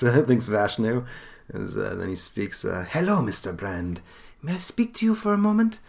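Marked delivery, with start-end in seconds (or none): 2.9-5.8 polite inquiry in a high-lifted character voice; Vashnu's voice. Acted. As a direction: put on a courteous, high-pitched questioner. Vashnu formally addressing Cleveland.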